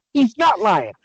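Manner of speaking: ordinary delivery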